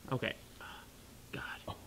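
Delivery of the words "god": whispered. Real, not acted. After painful dart hit.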